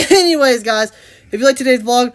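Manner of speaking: full-volume subscribe plug; loud vlog sign-off. Real, not acted. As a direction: call out the sign-off to viewers. Signing off the vlog to viewers.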